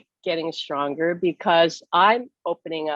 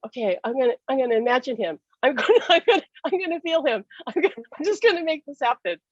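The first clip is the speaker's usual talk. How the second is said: high, rushed self-willed push with a laugh; determined insistence; 6 semitones higher, faster than usual. Retold or imitated. Widow willing her husband to appear, high and rushed. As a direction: force it to happen, half laughing. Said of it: "So I, I said".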